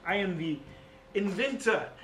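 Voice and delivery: boastful magic-item connoisseur with grand pauses; Louis Lightsword. Acted. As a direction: announce yourself grandly as a famed inventor. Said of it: "as an example with Louis Lightsword".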